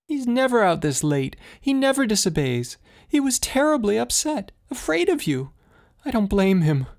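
high, breaking voice of a distraught mother; voice breaking with misery. A narrator's reading. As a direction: let the voice crack with grief. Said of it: "Her voice broke with misery".

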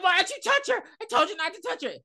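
high-pitched mock hysterical yell; unhinged screaming. Podcaster imitating the unhinged shooter accusing his friend, mock hysterical screaming. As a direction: rail at him in a high, frantic pitch. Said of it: "At this point, he's unhinged".

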